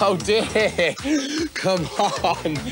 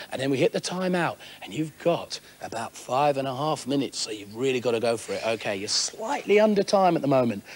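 game show host; 7 semitones lower than usual. Acted. Host explains the course rules.

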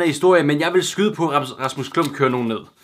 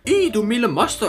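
high-pitched, mildly acted exclamation; storybook cry of alarm; 8 semitones higher than usual. A narrator's reading. Hippo lady startled by the steamroller, high acted cry. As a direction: cry out in high, hammed-up dismay. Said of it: "råbte flu-, fru Flodhest".

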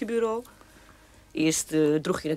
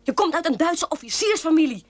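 higher and louder, hammering the accusation home; angry outburst; 7 semitones higher, 3 dB louder than usual. Acted. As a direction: shout it at your brother. Zus schreeuwt tijdens ruzie. (woedend uitschreeuwen tegen broer)

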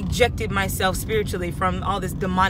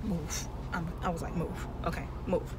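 quiet firm move; 6 semitones lower, 14 dB quieter than usual. Retold or imitated.

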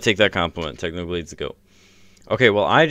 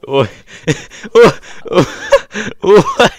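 laughing; 11 semitones higher, 6 dB louder, slower than usual. Real, not acted. Laughing disbelief.

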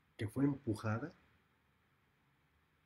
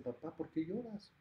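little daughter voice; 8 semitones higher, 5 dB quieter than usual. Acted. Daughters ask crying father.

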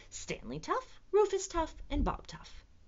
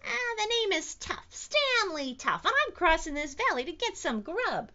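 high, loud little voice; plucky little dinosaur; 9 semitones higher, 5 dB louder than usual. A narrator's reading. Stanley answers the T-Rex, plucky high voice. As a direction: introduce yourself high and cocky.

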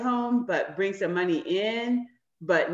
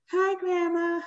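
high, put-on sweet hello; fake greeting; 9 semitones higher, slower than usual. Kids pretending to be glad to see grandma, fake-cheery. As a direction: mimic a phony kid greeting, pitched way up. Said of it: "It wasn't that fake".

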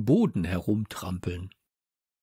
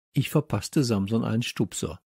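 plain reading voice

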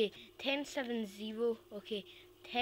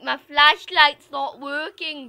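mock loud complaint; 2 semitones higher, 16 dB louder, slower than usual. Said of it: "I was like".

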